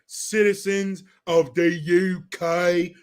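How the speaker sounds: drawn-out, lightly mocking British accent; slow hint of put-on British. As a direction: stretch it out in a playful British accent. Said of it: "I just love to do that accent".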